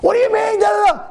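high imitating yell at someone; hammed-up furious outburst; mock angry shout. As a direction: mimic a man losing his temper. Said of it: "a person getting angry and going crazy".

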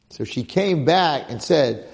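ordinary delivery

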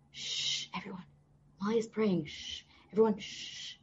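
soft shushing whisper to the room; hushing whisper. Retold or imitated. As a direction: hush everyone so she can pray. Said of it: "he quieted down the whole place".

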